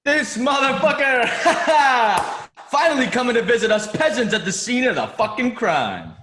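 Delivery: loud, high, laughing greeting; laughing shout. Acted. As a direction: burst out laughing at an old friend. Cook greets old coworker.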